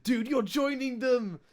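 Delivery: hammed-up high gushing; excited quote. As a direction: gush like a starstruck fan. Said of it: "I was like".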